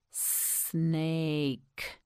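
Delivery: "Snake" slowly, with long S and long vowel; stretched out. Shown as a demonstration. Teacher models for learner, "Snake" slowly stretched from the S. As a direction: draw out every sound of "Snake". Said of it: "You say it".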